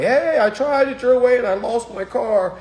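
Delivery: high, pleading mock complaint; squeaky voice of a failed student; mocking whiny student. Student whining to the teacher about his lost car. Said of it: "when they say things like".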